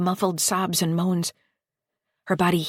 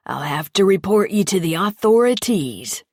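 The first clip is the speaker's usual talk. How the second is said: mock official tone; 6 dB louder than usual. Lester mock-threatens Patty.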